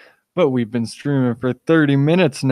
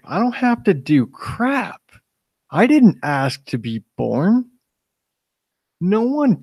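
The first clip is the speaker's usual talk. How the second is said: defiant; 6 semitones higher than usual. Real, not acted. Defiant rant against duty.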